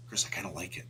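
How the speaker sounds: soft unvoiced whisper. Real, not acted. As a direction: breathe it like a secret. Unvoiced aside.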